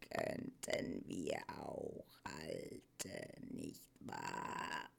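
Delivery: very low, soft, slow grunt; grunting old Morla. A narrator's reading. Morla muses to herself before refusing Atreyu, grunting. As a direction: grunt it to yourself, low and weary. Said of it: "grunzte die Morla".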